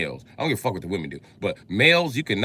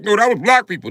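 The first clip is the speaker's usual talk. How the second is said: higher, louder insistent objection; mock Israelite; 10 semitones higher, 8 dB louder than usual. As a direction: mimic them insisting.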